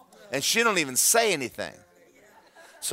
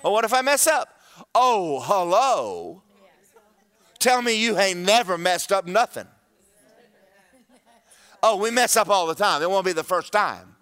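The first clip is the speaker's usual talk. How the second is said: high, raised preacher's voice; full-force preaching; 5 semitones higher, 5 dB louder than usual. Acted. Preacher confronting the congregation about not acting, high and forceful.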